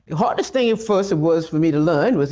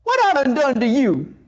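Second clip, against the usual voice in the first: yelling at God; 10 semitones higher than usual. Acted. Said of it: "start yelling at God".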